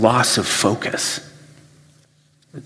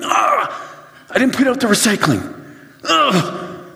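higher, fast, ending in a groan; sudden oh-no, then a frustrated ugh; comic dismay, higher and fast; dismayed realization; 13 semitones higher than usual. Retold or imitated. Late-night realization, self-quote.

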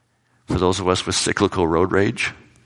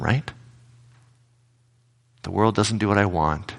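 ordinary talk, nothing marked

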